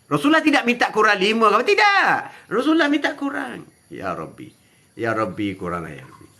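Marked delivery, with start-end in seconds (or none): 3.9-6.3 much lower, much softer begging; humble plea. Acted. Prophet begging his Lord for less, very low and soft. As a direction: plead almost under the breath.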